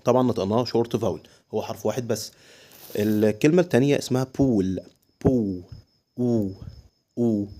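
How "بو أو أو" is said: "بو، أو" with a held oo, each sound alone; long vowel demo. Shown as a demonstration. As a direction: stretch the oo in "بو", then repeat "أو" by itself. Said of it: "كده بقت لونج فاول". ("بو، أو" بمد الصوت)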